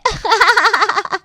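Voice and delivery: high-pitched giggling laugh; gleeful laugh. Acted. Moni laughs after cheering both sisters-in-law, gleeful. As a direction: burst into a merry string of ha-ha-ha.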